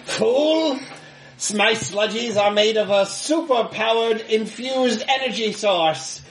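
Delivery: high-pitched gloating villain, drawn out; industrialist villain. Acted. Game master as industrialist taunting the player, high villain voice. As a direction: play a hammed-up cartoon villain boasting. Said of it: "the industrialist looks at you and says".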